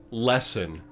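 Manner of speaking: usual speaking voice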